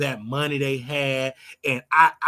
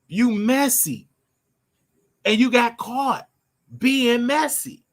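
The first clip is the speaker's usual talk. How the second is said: slow scolding; 8 semitones higher, 4 dB louder, slower than usual. Real, not acted. Ranting at Shirley Strawberry.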